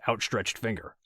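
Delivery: plain reading voice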